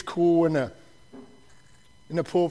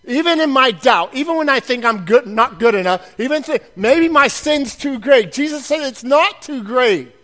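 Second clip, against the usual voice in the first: loud, driving preacher cadence; rally the congregation with a booming promise; preaching at full force; 5 semitones higher, 8 dB louder than usual. Acted. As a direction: preach it out, louder with each line.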